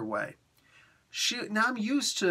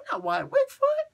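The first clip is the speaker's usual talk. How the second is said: very high-pitched disbelief; incredulous protest. Real, not acted. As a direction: squeak it out in protest. Protests character acting wrongly.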